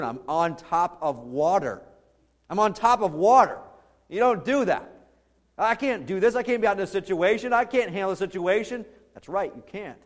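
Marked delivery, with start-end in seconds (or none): 0.0-1.8 slow, disbelieving realisation, near usual pitch; slow, mildly alarmed realisation; dawning alarm. Acted. Peter's dawning alarm, first take. 2.5-8.9 high, loud acted alarm; raised, frantic protest; Peter's panic.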